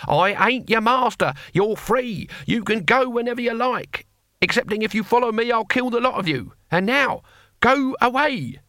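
put-on high-pitched old warrior losing patience; exasperated. Cohen angrily dismisses clinging ex-prisoners.

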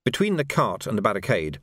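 usual speaking voice

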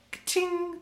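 very high jingle sound; ad jingle ding. Pretend. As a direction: ring it like a commercial chime.

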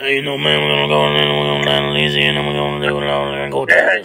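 very low gravel growl, words run together; Coach O. Acted. Podcast host showing up co-host with his impression, deep growled coach voice. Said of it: "Why do I do a better Coach O".

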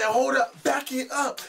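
high, loud retold objection; acted-out alarmed disbelief; flabbergasted protest. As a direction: call a sudden halt, voice jumping up.

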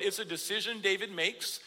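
ordinary talk, nothing marked

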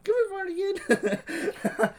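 high, drawn-out call to an imaginary dog; slow, high-pitched mock pet call; pretend dog call. Calling an imagined dog named Fartigan.

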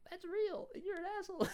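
high, whiny mock girl voice; mocking a girl who insulted him, high whiny imitation; mocking her voice. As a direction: sneer it back in a squeaky put-on voice. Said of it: "the same fucking bitch said".

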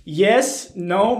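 ordinary delivery

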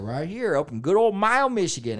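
high, loud folksy country twang; hammed-up hometown country accent. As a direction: proudly announce it in mock country accent. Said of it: "they're just like".

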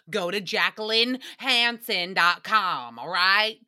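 slow drawl sliding into a Southern accent. Real, not acted. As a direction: stretch the name out, then roll into Southern. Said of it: "I drawled it out, and then we just rolled into a Southern accent".

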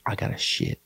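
whispered urgently, no voice; hushed plea not to scare the turkeys. Retold or imitated. Brother whispering he has to go. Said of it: "He's like".

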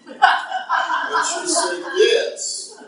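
slow, high laugh through the words. Real, not acted. Preacher laughing as he quotes his wife. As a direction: drag it out while laughing. Laughing retelling wife's answer.

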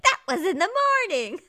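high, loud, fast and laughing retort; snippy Mary. Retold or imitated. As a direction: snap it back through a laugh. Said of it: "And she was like".

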